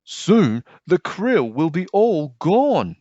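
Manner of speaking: high, loud and slow, full of dread; worried high fish voice. Acted. One fish warning the school, high, slow and worried. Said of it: "said one".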